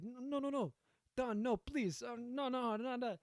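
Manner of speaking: high, frantic mock begging; panicked victim act, pitch shooting up; mock pleading. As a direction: beg the mob boss for mercy, hammed-up. Acts out pleading with the Don.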